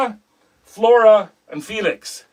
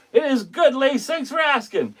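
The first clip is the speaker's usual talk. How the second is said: normal talking voice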